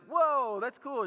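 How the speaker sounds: high, loud quick whoa; husband glancing at the mountains. Retold or imitated. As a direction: toss off a brief wow. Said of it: "I'd be like".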